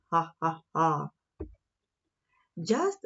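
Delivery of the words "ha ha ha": lower, spoken mock laugh; mocking laughter. Eagles laughing at the little mouse, low mock laugh. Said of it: "All the eagles fell about laughing".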